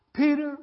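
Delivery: Peter called out high and loud; name called out. Acted. Preacher calls out the name.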